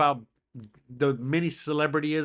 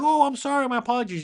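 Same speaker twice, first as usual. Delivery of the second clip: higher, louder imitation of a hasty apology; apologetic fan; 8 semitones higher, 5 dB louder, faster than usual. Fan apologises to YouTuber, mock apologetic. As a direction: blurt it out as a flustered apology.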